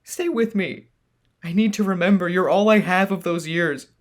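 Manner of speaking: high, loud tearful begging; desperate pleading. Acted. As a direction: plead with him not to go. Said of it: "begged with a trembling voice".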